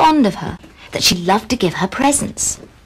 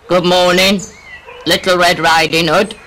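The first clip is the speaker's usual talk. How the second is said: wolf voice; 2 semitones lower than usual. Acted. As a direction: play the sly wolf. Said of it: "said the wolf".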